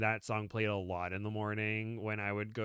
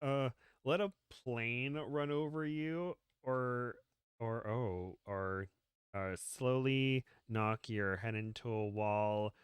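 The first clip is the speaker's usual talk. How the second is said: slow, deliberate read-aloud; story narration; 3 semitones higher, much slower than usual. Acted. Reading grim comic options to a friend, slow storyteller delivery. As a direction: read slowly, pausing between options.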